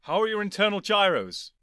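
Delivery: high, loud urgent question; urgent shout. Acted. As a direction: call out to the robot. The Doctor calling to K9.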